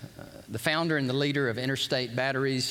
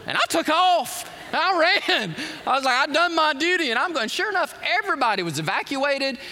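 excited retelling; 11 semitones higher, 5 dB louder than usual. Acted. Retelling running off.

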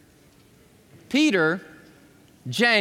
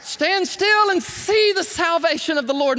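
higher, louder proclaiming cry; Moses crying out; 9 semitones higher, 4 dB louder than usual. Retold or imitated. Moses crying out to the people at the sea, loud ringing proclamation. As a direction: ring it out in triumph. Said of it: "Moses cried".

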